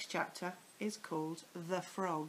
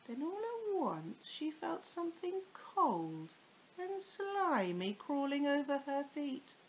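suspenseful; 7 semitones higher than usual. A narrator's reading. Suspense in the story.

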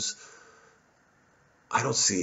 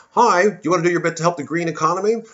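higher, chirpy sales pitch; mock cold caller; 8 semitones higher than usual. Comedian plays a caller selling green policy, chirpy. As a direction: pitch it like a cheery telemarketer. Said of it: "if you were to call".

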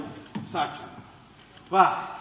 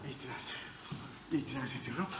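faint muttered objection; 10 semitones lower, 17 dB quieter, faster than usual. Acted. Patient keeps objecting, fading.

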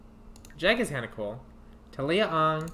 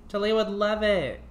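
higher and louder, thrilled; excited; 8 semitones higher, 5 dB louder than usual. Real, not acted. Gamer cheering the new oceanic lot. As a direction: announce it like a grand reveal.

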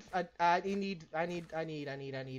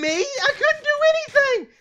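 whiny protest; 6 dB louder than usual. Real, not acted. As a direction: wail the excuse. Defends himself, wailing.

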